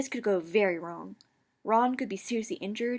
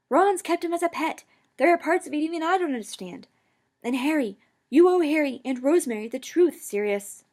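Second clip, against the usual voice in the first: ordinary delivery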